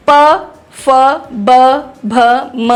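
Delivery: प फ ब भ म with lips meeting, one by one; spaced-out demo of labial प फ ब भ म; lip sounds प फ ब भ म, each said separately. Shown as a demonstration. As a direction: bring the lips together for each of प फ ब भ म. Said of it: "होंठ आपस में टकराते हैं".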